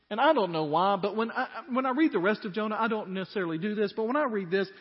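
loud, high impassioned sermon voice; fiery preacher retelling Jonah; full-force preaching. Acted. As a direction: preach it out to the back row.

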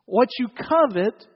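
ordinary delivery

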